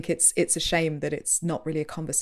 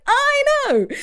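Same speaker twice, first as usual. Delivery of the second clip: very high, loud drawn-out squeal; hammed-up exasperated yell; mock exasperation; 9 dB louder than usual. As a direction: shriek it in comic frustration. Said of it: "And it's like".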